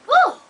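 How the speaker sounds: high, loud exclaimed whew; emotional whew. Real, not acted. Mother recalling the sick babies, overwhelmed whew. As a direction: let out a sudden high whoop of breath.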